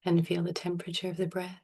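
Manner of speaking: soft, unhurried meditation voice with long pauses; guided meditation. Real, not acted. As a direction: gently guide them into stillness, phrase by phrase. Leads a breathing meditation.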